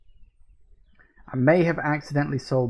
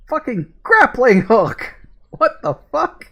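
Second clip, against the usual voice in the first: loud, higher, slow burst of disbelief; shocked excited yell; 9 semitones higher, 6 dB louder, slower than usual. Real, not acted. Gamer shouting at a surprise grappling hook, loud and amazed. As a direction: yell it out in stunned delight.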